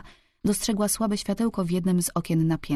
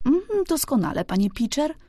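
high-pitched housekeeper's reply; brisk housekeeper; 8 semitones higher than usual. A narrator's reading. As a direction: answer in a raised character voice. Housekeeper answers the old servant.

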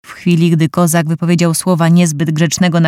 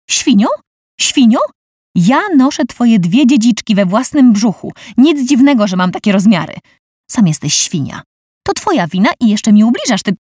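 indignant wife; 5 semitones higher than usual. Acted. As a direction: scold him, pitch rising. Angry pregnant wife scolds husband.

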